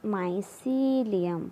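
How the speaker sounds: drawn-out mycelium; slow term. Shown as a demonstration. Teacher announcing the term mycelium slowly to the class. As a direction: say "mycelium" slowly and clearly, falling at the end. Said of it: "Next come to our third point".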